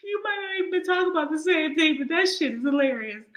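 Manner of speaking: high, breaking voice through real laughter; words squeezed out between laughs; laughing hard. Real, not acted. Host reacting to a funny viewer comment, laughing hard. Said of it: "why I'm laughing".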